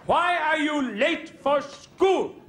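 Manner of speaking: high, loud slow mock teacher demand; hammed-up schoolmaster; stern teacher. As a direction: drag it out sternly. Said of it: "and he said".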